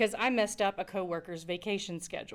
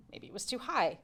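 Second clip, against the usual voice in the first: lifted, higher voice with "high" raised; pitch matching "high"; 4 semitones higher, 4 dB quieter than usual. Acted. Storyteller offering the opposite guess, pitched up on "high". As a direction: send "high" up in pitch, lightly.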